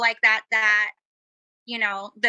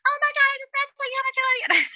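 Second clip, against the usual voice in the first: high, fast gushing rush; gushing fan; 12 semitones higher, faster than usual. Retold or imitated. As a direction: blurt it all out in one breath. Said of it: "And I was like".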